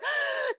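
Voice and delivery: high dramatic gasp; shocked gasp. Retold or imitated. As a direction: gasp in mock alarm. Mimics her panicked reaction.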